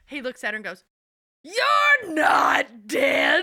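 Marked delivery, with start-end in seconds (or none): 1.4-3.4 very slow, loud, high, wailing declaration; milk every syllable like a grand tragedian; dramatic line reading. Retold or imitated. Sin eater telling the widow she lives, hammed-up slow wail. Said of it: "amazing line reading".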